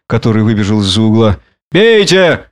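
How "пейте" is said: high-pitched commanding cry; shouting. A narrator's reading. Drunken man urges beasts to drink, shouting. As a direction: call the order out high. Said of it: "кричал Монгомери".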